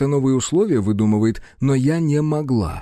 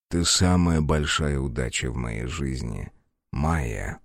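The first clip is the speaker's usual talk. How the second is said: tender low voice; 10 semitones lower, 3 dB quieter than usual. Acted. Snake's tender low confession.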